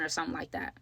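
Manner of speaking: normal talking voice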